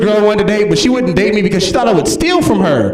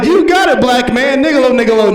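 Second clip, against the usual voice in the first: put-on gleeful kid; 6 semitones higher than usual. Kids chanting back as they skip.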